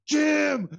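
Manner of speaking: sudden high shout of the name. Retold or imitated. Imitating the caller suddenly shouting Jim. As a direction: snap from calm into a yell. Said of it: "so he's just talking normal".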